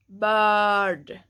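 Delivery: "bird" held, falling; English example. Shown as a demonstration. Teacher comparing ö to English bird. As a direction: hold the vowel of "bird". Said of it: "like the I in bird".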